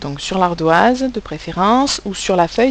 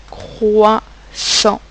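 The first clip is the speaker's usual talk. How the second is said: CROISSANT slowly in two beats, crois, pause, sant; split into syllables. Shown as a demonstration. Teacher correcting the spelling with pupils, split into syllables. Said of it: "comment il fallait écrire le mot".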